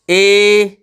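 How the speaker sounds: ए held long and steady. Shown as a demonstration. Teacher gives a long sound example, long A. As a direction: stretch ए like the letter name. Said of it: "long sound का example ले लें".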